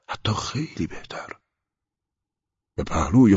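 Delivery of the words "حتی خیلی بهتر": hushed whisper. Acted. Dying man reassures himself.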